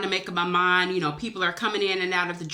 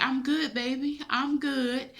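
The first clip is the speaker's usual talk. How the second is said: higher, softer imitation of grandmother; grandmother's reassuring reply; 6 semitones higher, 4 dB quieter than usual. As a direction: gently voice a reassuring grandmother in a raised pitch. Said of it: "And she was like".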